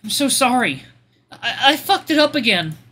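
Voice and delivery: high, tearful, stammered apology; sobbing apology. Acted. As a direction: apologise through sobs. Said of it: "and he sobs".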